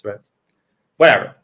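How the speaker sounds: normal talking voice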